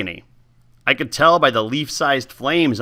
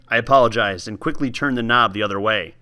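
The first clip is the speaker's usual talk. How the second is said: ordinary delivery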